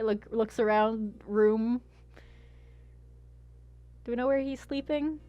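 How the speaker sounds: ordinary delivery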